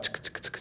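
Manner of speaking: whispered tick tick of street sellers; imitated ticking. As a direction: click it out under your breath. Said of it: "the guys that are like".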